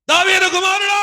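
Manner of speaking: high, loud desperate shout for help; desperate cry. Retold or imitated. Blind beggar cries out to Jesus over the crowd, shouting. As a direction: cry out as if your life depends on it. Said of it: "అని అరుస్తున్నాడు".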